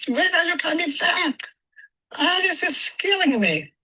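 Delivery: wife pleading over the phone to come home; wife calling. Retold or imitated. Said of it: "my wife would be calling".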